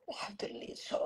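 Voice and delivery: breathless tearful whisper. Acted. Mother whispering thanks while crying over her daughter. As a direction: whisper a prayer through tears.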